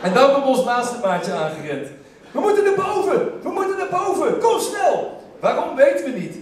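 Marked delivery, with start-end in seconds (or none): 2.3-5.2 high, breathless urging to come upstairs; urgent call. Retold or imitated. Mate rushing in urging friends upstairs, high excited call. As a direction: hurry them along, excited.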